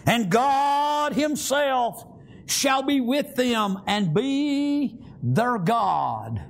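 high, slow, drawn-out proclamation; solemn divine pronouncement, stretched out; great voice from heaven. Acted. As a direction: give God's voice booming weight, very slowly. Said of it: "a great voice out of heaven saying".

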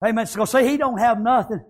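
ordinary talk

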